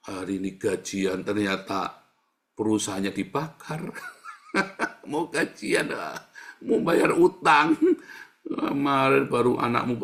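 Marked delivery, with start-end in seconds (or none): 4.0-5.1 breathy voiceless chuckle; short burst of laughter; amused laugh. Real, not acted. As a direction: laugh at your own joke. Laughs at his own example.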